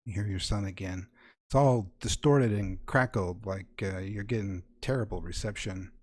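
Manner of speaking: ordinary talk, nothing marked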